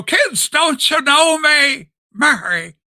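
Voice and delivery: high, slow, loud pleading George Bailey; a hammed-up desperate plea to his family; Jimmy Stewart impression. Host acting out George Bailey begging his wife. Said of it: "goes through such a range of emotion".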